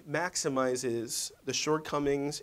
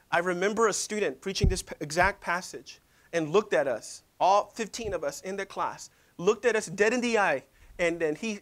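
normal talking voice

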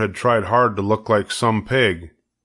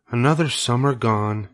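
sighing; 3 semitones higher than usual. A narrator's reading. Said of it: "she sighed".

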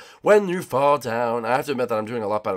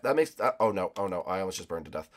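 ordinary talk, nothing marked

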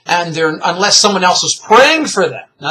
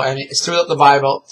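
ordinary talk, nothing marked

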